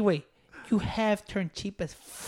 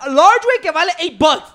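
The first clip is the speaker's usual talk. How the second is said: outraged emphasis; 6 semitones higher, 5 dB louder than usual. Real, not acted. Outraged at a friend's pricey order.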